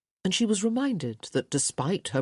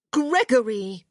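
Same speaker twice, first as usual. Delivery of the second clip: exasperated call; 7 semitones higher, 5 dB louder than usual. A narrator's reading. Sister exasperated at teasing brother.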